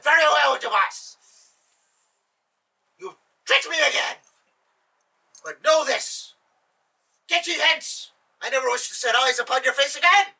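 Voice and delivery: furious Sultan. Acted.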